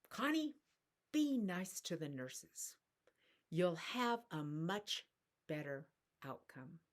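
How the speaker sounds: put-on a touch of New York accent. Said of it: "in that particular way".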